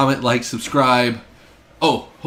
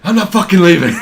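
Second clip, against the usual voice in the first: loud, raised mock-defiant shout; movie rant impression; 6 semitones higher, 9 dB louder than usual. Co-host echoes the Wolf of Wall Street post, mock defiant shout. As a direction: belt it out like a rousing refusal. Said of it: "he posted the Wolf of Wall Street".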